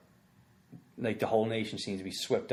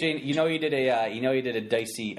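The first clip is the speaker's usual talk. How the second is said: usual speaking voice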